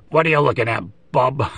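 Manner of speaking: a gruffer repeat of the Wolverine line; Wolverine impression. Collector repeating his Wolverine bit for viewers, gruff mock growl. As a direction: growl it out again like the cartoon Wolverine, half laughing. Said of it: "Trying to do my best Wolverine animated series, uh, impression".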